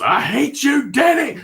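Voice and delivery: a high, furious yell at the father; imitated angry outburst. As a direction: shout it like a delusional patient lashing out. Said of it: "he gets this angry look on his face, and he just goes".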